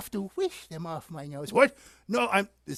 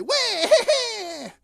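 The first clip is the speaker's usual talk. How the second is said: very high, loud bleating wail; hammed-up crying bleat; mock wail; 5 dB louder than usual. Old sheep joins the baby's crying, mock wail. Sheep now cries like the baby.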